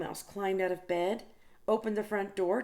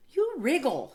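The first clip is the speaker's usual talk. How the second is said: higher, louder mock complaint; a grumpy refusal, pitched up; Big Mouse grumbling; 7 semitones higher, 6 dB louder than usual.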